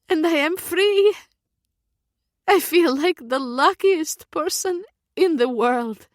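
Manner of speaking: high, loud, laughing triumph; elated joy. A narrator's reading. Freed girl after hearing her family, laughing with joy. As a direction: let it burst out with a laugh.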